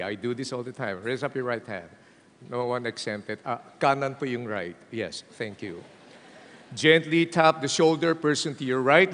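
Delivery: ordinary talk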